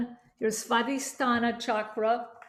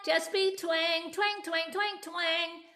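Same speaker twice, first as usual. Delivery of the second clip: higher, pinched nasal "twang, twang"; 6 semitones higher, slower than usual. Shown as a demonstration. Coach leading students in twang drills. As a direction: ring the word twang through the nose. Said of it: "Try that".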